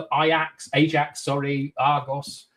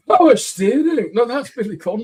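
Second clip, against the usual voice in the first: high, loud mock Sean Connery muddle; a jokey, hammed-up Scottish film star voice; confused Connery mimic; 8 semitones higher, 7 dB louder than usual.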